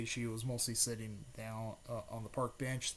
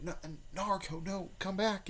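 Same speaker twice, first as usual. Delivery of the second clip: calling out; 8 semitones higher than usual. A narrator's reading. Friends call after Naruto running off.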